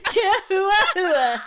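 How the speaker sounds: drawn-out word through a laugh; laughing chihuahua. Real, not acted. Co-host laughing at her own joke, drawn out and high.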